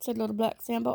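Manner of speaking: ordinary delivery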